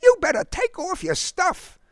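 put-on high boyish urging. A narrator's reading. Boy urging his soaked friend.

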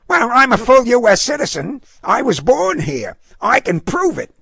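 very high, indignant woman; feisty dog owner, affronted. A narrator's reading. Dog owner misunderstands the demand for papers, affronted. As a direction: insist hotly in a high-pitched voice. Voices Missus Harrigan protesting.

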